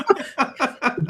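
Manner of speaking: high burst of laughter. Real, not acted. Laughs at joke.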